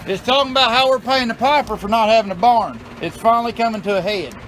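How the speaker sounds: retorting to his wife, loud and high; loud rant. Real, not acted. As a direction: push it up high and loud, protesting. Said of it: "I've been doing a rant video".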